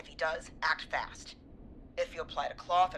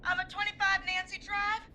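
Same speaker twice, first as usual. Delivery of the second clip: panicked caller; 14 semitones higher than usual. Acted.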